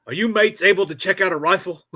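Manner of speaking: a hint of a Royal Marine checking up; Royal Marine NCO. Royal Marine NCO to hungover Marines, lightly imitated. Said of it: "Royal Marine, like, N C O".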